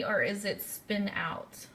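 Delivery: ordinary delivery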